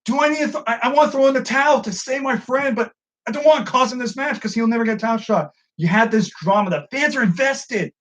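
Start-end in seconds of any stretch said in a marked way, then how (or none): 0.1-5.5 high, fretful worried voice; pitched-up anxious inner voice; voicing MJF's dilemma. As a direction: act out MJF torn about the towel. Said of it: "He's like".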